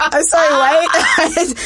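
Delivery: high, loud laughing "what?"; laughing disbelief. Retold or imitated. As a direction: shout it through a laugh, mock-baffled. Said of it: "I'm like".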